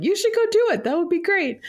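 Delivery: high-pitched, enthusiastic quote; bright retold enthusiasm, pitched high; eager encouragement. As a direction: imitate a husband cheering you on. Retells husband's eager encouragement.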